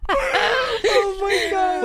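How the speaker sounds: very slow and high, laughing through the words; laughing helplessly. Real, not acted. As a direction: gasp it out while still cracking up. Laughing hysterically.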